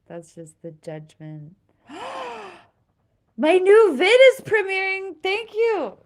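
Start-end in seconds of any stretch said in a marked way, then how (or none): 1.9-2.6 sharp high gasp of surprise; surprised gasp. Real, not acted. Notices her video premiering. 3.4-6.0 much higher, louder thrilled announcement; excited. Real, not acted. Notices her video premiering.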